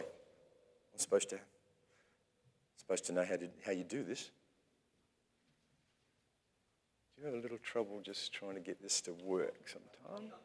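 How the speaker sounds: low, soft off-mic muttering; quiet aside. Real, not acted. Speaker asking a helper about balky equipment, quiet aside.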